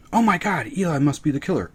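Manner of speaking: high fake gasp; mock audience. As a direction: parody the predictable guess. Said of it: "episode seven".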